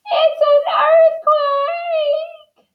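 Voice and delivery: very high, slow, drawn-out wail; panicked shout. A narrator's reading. Missus Watson as the bed falls, high panicked shout. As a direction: shriek it, stretching the last word. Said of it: "shouted Missus Watson".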